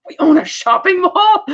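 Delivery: high, slow, incredulous question; mock disbelief. Emmett on the phone to Varga, slowly and incredulously surprised. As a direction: sound baffled that they own a mall. Emmett hears about the mall.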